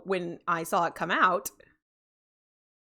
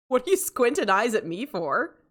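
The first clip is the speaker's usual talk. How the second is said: ordinary talk, nothing marked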